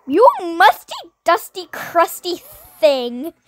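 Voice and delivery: loud high taunt at the rat; taunting yell. Acted. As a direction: shout the insult, mocking. Yelling insult at the rat.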